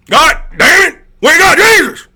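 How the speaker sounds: very high, shouted Southern caricature; mock Southern accent. Streamer peaking in mock country pride, Southern-accented yell. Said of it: "make fun of people from the South".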